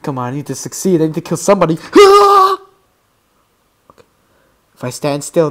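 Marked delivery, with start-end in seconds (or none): 1.8-2.6 high, voiced gasp; loud alarmed gasp. Real, not acted. Player trying to succeed in game, startled gasp. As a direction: gasp loudly in shock.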